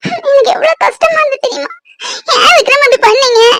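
high, sobbing cry of hurt. Acted. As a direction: wail how much it hurt. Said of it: "அழாத பூமாரி".

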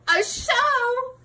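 high, surprised pickup; surprised question. A narrator's reading. To the listening children about the show, surprised. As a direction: act amazed at the news.

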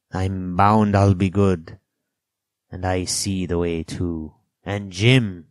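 put-on slow, emphatic old castaway; Ben Gunn, earnest castaway. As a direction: ramble earnestly, leaning hard on phrases.

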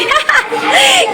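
real burst of high laughter; bright high laugh shout. Real, not acted. Laughs at kids' singing.

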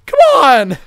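high loud slow begging; desperate pleading. Real, not acted. Gamer begging an opponent to go backwards, high and loud. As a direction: beg the enemy to back off.

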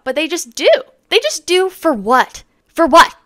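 high, rising outrage; indignant. Real, not acted. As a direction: demand an answer. Indignant repeated question.

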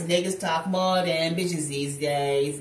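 quoting [name] lyric. Retold or imitated. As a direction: lay the line down slow. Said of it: "Ain't that [name]?".

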